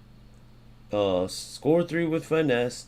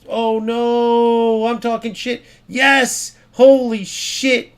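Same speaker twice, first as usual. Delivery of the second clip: loud, high, drawn-out cries; real gamer outburst at full volume; excited shouting; 10 semitones higher, 9 dB louder, much slower than usual. Real, not acted. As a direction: yell from dismay into celebration. Sudden turn in the match.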